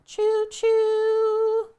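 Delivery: high, loud choo choo; train whistle call. Pretend. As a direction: toot it like a happy train. Celebrating level two hype train.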